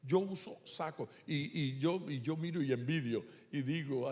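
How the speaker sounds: ordinary talk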